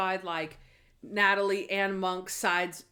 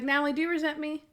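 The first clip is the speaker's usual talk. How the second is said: high, quick questioning imitation; lightly voiced anxious Monk; 6 semitones higher, faster than usual. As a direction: ask fast in a lightly raised voice. Voices Monk asking Natalie.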